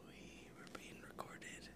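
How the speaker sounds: hushed whisper. Real, not acted. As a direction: whisper it so the camera won't catch. Camera came on, hushed.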